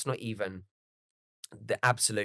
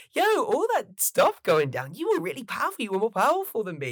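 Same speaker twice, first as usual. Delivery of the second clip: much higher, cheerful Goku impression; goofy Goku voice; 11 semitones higher, 4 dB louder than usual. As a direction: chirp it like an easygoing hero. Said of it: "Goku turns around and goes".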